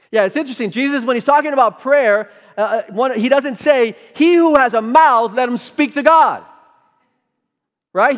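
loud, high, driving preacher voice; full-force preaching. Acted. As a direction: preach it hard to the congregation.

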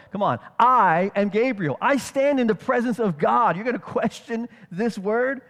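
emphatic, loud rebuke; Gabriel. Acted. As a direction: put him firmly in his place. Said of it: "Gabriel responds emphatically".

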